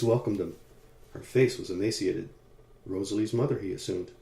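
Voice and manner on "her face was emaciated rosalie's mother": plain reading voice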